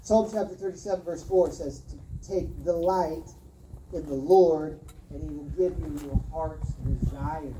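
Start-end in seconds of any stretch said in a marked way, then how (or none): none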